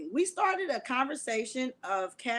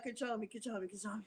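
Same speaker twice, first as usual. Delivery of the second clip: fading, much softer pleading; 3 semitones lower, 12 dB quieter, faster than usual. Real, not acted. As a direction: whisper it to yourself to calm down. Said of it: "get the laughter out of me".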